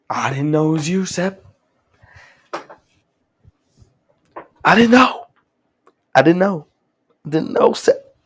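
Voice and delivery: hammed-up angry shouting, pitch climbing high; loud, high, over-the-top tantrum; angry outburst. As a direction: yell it loud and high, building in force. Acting out an angry character's outburst.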